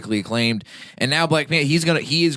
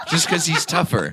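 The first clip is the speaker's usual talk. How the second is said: talking through laughter, higher pitched; real laughing; 5 semitones higher than usual. Real, not acted. Podcaster justifying his Rock versus Kevin Hart joke, laughing. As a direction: laugh the words out.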